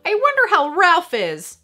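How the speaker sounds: put-on dreamy kid wondering aloud; wistful child. A narrator's reading. Retelling Lex missing Ralph, girlish voice.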